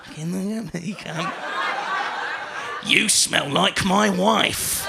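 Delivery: leer it out like a pensioner; old man leering at the comedian; old drunk man. Retold or imitated. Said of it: "Or it's".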